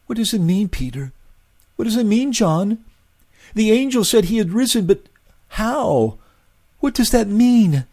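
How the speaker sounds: high, pleading and confused woman's voice; bewildered Mary. A narrator's reading. Mary begging the apostles to explain, high and bewildered. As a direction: ask in rising confusion.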